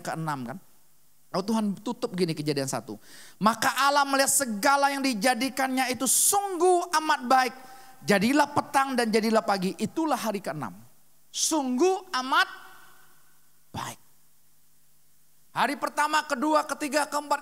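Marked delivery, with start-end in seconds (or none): 3.4-10.7 louder, raised bible reading; scripture reading. Acted. Preacher reads Genesis one to the youth, loud and raised. 11.3-14.0 drawn-out emphasis. Shown as a demonstration. Preacher savours God's words for the youth, slow and drawn. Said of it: "dwelling on the phrase".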